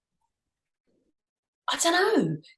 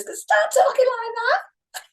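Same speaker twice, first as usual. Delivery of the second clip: high-pitched northern; 12 semitones higher than usual. Shown as a demonstration. Host demonstrates her nervous voice to co-host, high-pitched northern. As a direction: shoot up into a high northern English accent. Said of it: "I go high-pitched northern".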